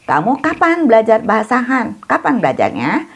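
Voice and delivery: ordinary talk, nothing marked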